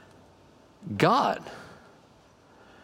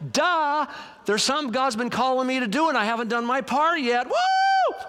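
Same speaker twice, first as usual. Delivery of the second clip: high-pitched dawning realization, ending in a whoop; acted realization; 9 semitones higher than usual. Devotions reader realizes a forgotten duty, acted realization. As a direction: play up the penny dropping.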